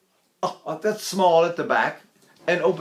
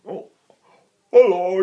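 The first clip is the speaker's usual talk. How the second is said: loud, hammed-up affected hello; mock the pushed voice; mock unnatural hello; 3 semitones higher, 5 dB louder than usual. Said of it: "speak like that".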